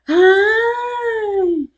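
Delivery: high, slow sing-song talking down; mocking a sugary hello as if to a child; condescending greeting. Mimicking the lady greeting her like she's stupid, patronizing sing-song. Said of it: "talking to me like I'm a stupid person".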